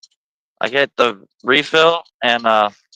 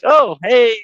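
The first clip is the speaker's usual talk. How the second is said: surprised greeting; 8 semitones higher, 4 dB louder than usual. Retold or imitated. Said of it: "I'm like".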